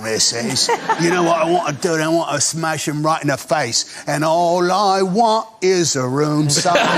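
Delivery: Cockney sliding into slow sing-song; bad Cockney. Shown as a demonstration. Actor failing at a dialect session, hammed-up Cockney turning musical-theatre. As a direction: attempt Cockney, drifting into Eliza Doolittle. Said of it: "trying to play somebody Cockney".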